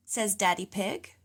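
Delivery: ordinary delivery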